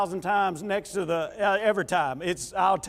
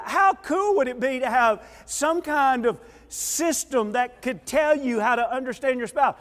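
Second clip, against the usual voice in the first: usual speaking voice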